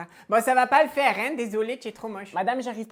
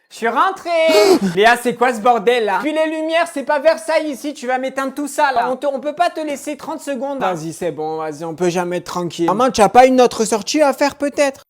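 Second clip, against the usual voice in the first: loud, scolding the mother like a parent; angry teen; 10 dB louder than usual. Acted. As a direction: tell her off with loud exasperation. Ado engueule sa mère.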